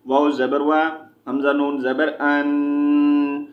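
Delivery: measured chant, syllables held long; Quran spelling drill. Shown as a demonstration. Teacher spelling Quranic words for learners, chanted drill. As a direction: spell out each letter, then draw out the joined syllable.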